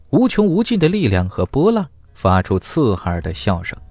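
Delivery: plain reading voice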